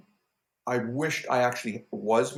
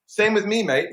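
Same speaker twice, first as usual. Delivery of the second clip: put-on a high, louder retry of a hammy Australian accent; bad Australian accent; 9 semitones higher, 5 dB louder than usual. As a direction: give the Aussie line another bad go. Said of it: "that was a terrible Australian accent".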